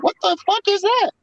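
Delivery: high squeaky mimic of players questioning the play call; mock confused players. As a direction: squeal it like a clueless teammate. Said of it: "players would be like".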